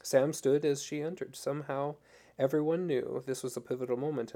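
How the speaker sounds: plain reading voice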